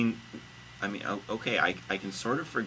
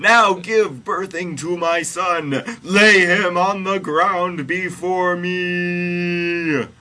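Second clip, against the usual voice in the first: high, very drawn-out shouted command; God screaming; 10 semitones higher, 12 dB louder, much slower than usual. A narrator's reading. Host reading God commanding Mary, screaming. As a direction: bellow the order, stretching the final words. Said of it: "screamed God".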